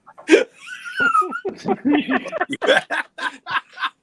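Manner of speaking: high hearty laughter; long loud laugh. Real, not acted. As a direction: laugh hard. Laughs after fuck you Ed.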